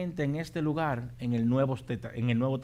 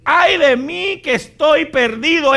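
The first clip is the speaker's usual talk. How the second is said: guilty man's cry; 13 semitones higher, 14 dB louder than usual. Retold or imitated. Preacher voicing a guilty man's lament, high and loud. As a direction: wail it like a sinner undone. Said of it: "es lo que nosotros decimos".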